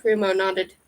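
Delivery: ordinary delivery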